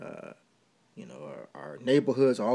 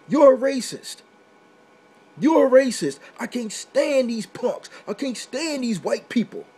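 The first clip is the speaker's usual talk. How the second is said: higher and louder, indignant yelling of the accusation; mock angry outburst; 8 semitones higher, 4 dB louder than usual. Vlogger mimics his community lashing out, mock angry yell. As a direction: throw the accusation back, hot and offended.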